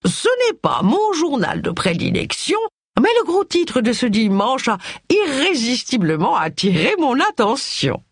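put-on high, nasal elderly lady voice; nasal old aunt. A narrator's reading. Old aunt remarks on the Sunday headline, high and nasal. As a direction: sound like a prim old aunt peering over her paper.

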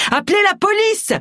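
urgent high-pitched shout; crying out. A narrator's reading. Agatha calls for help after a gunshot, crying out urgently. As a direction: raise the alarm, voice shooting up. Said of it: "cria-t-elle".

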